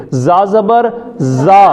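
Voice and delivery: زا زبر زا drawn out slowly, hissing the z; slow whistled z; slow spelling. Shown as a demonstration. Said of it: "سیٹی والے حروف".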